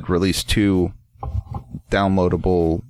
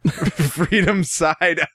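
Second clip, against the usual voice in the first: higher, slow, laughing repeat; 9 semitones higher, much slower than usual. Real, not acted. A friend repeating the egg joke, laughing. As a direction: savour the joke through a laugh.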